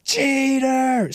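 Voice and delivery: very high mock scream of "Cheater!"; high mock fan scream; shrill yell.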